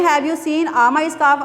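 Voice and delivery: very slow, showing how odd it sounds; slow wrong example. Shown as a demonstration. Teacher slowly testing a wrong article. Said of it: "odd feel हो रहा होगा".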